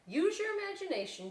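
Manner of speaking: high bright reply; cheery teacher reply. Acted. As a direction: encourage them lightly. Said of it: "she replied".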